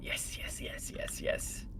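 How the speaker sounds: whispering. Retold or imitated.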